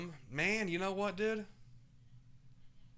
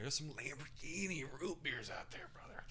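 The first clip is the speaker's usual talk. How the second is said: whisper; 8 dB quieter than usual. Real, not acted. Hushed aside about root beers.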